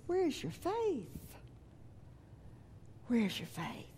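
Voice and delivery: soft, slow questioning of the disciples; Jesus turning back to sleep, soft rebuke; gentle rebuke. Retold or imitated. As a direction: ask it quietly, almost tenderly. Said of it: "he looks at them and he says".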